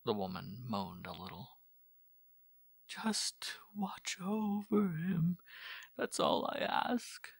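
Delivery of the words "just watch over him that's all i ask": slow, breathless whispered plea; whispered prayer. Acted. Mother prays alone in whisper.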